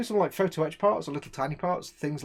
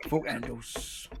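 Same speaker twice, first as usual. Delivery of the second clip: slower, quieter London "Fuck handles" repeated; London accent; 3 semitones lower, 4 dB quieter, much slower than usual. Shown as a demonstration. Repeating the joke to the mates in a slow London accent. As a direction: echo it in London accent, dropping away. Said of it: "your brilliant London accent".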